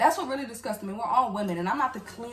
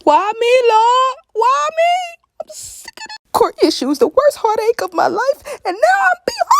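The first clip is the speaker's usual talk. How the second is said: high, loud hammed-up sobbing; over-the-top crying lament; mock wailing; 15 dB louder than usual. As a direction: wail to heaven in exaggerated despair. Acts out wailing after leaving office.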